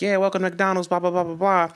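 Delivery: lightly voiced high-pitched drive-thru greeter; drive-thru worker. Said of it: "They're like".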